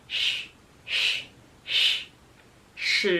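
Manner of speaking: long sh like hushing someone; shushing sh. Shown as a demonstration. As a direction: shush like telling someone quiet. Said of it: "telling you to quiet down".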